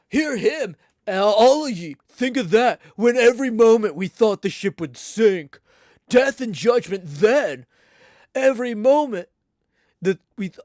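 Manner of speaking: put-on loud, high, indignant old sea captain; an old captain thundering at the cabin, outraged; Bildad's retort. Old Bildad scolding everyone in the cabin, loud and indignant.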